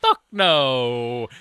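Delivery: loud, drawn-out mock refusal; punchline shout. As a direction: belt it out like a comedian's closer. Friend re-performs the punchline.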